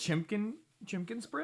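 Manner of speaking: put-on chimkin said in baby talk, ch softened to sh; cutesy chimkin with a high lilt. Teasing baby-talk for chicken.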